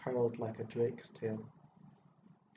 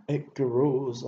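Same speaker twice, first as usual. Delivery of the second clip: angry repeat, louder, stress on grows; sputtering louder; 3 semitones higher, 8 dB louder than usual. A narrator's reading. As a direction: spit out grows in frustration. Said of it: "stuttered all the rest".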